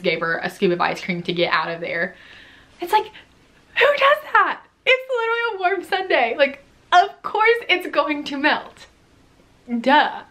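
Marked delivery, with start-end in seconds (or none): 2.8-10.2 incredulous disbelief. Real, not acted. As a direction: stretch it out like nobody could be this silly. Exasperated comment on the customer.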